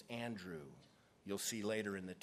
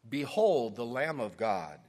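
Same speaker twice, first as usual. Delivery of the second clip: ringing proclamation, louder; proclaiming; 7 dB louder than usual. Acted. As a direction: announce it to the crowd. John proclaims Jesus while reading.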